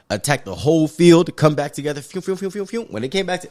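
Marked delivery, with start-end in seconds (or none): 2.0-2.8 quick, higher, soft whooshes; rapid string of little flying-object noises; imitated whooshing. Fan acting out hammer pieces flying back, light mouth-made whooshes.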